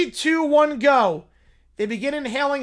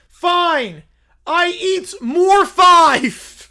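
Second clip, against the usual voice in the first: higher, louder, slow dim bear shout; 8 semitones higher, 10 dB louder, much slower than usual. Acted. Little Brown Bear shouting about romance, slow and loud. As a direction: bellow it like a lovesick bear.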